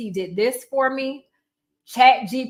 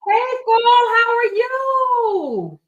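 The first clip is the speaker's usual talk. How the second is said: high, loud, long drawn-out hello; excited greeting; 12 semitones higher, 6 dB louder, much slower than usual. Real, not acted. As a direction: sing out a delighted welcome, stretching the last words. Greets a viewer joining live.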